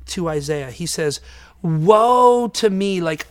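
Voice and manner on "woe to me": loud, drawn-out lament; cry of woe. Acted. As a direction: wail it out slowly and loudly. Acts out Isaiah's alarm before God.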